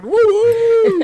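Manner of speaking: very high, loud whooping cheer; mock whoop. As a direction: whoop like a victorious fool. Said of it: "he's like".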